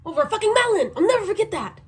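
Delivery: high, loud outrage at the screen; real exasperated protest; indignant outburst. Real, not acted. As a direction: blurt it out, high and aggrieved. Indignant reaction to a show's scene.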